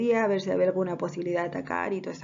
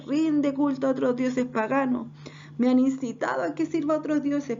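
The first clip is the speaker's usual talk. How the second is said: higher, impassioned acted appeal; aggrieved David pleading; 5 semitones higher than usual. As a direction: plead your innocence to the king, pressing each question. Reads David's speech to Saúl in role.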